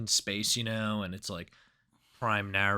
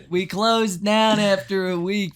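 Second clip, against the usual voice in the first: put-on high, louder, slow old-man voice through laughter; mock old shopkeeper laughing; 7 semitones higher, 6 dB louder than usual. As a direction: laugh your way through the old geezer's sad tale. Joining the old-man bit.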